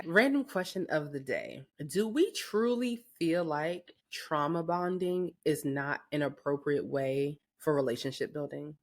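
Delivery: ordinary talk, nothing marked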